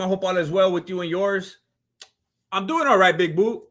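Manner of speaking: usual speaking voice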